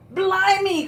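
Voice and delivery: loud, high pirate exclamation; big theatrical cry of disbelief. A narrator's reading. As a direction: burst out like an appalled captain. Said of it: "cried Pete".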